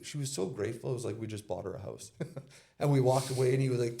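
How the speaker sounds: usual speaking voice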